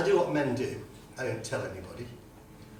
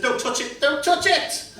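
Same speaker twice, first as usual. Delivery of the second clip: mock panic, higher and louder, building; hammed-up yelp of alarm; panicked shout; 12 semitones higher, 8 dB louder than usual. Begging his partner not to touch, a hammed-up panicked shout.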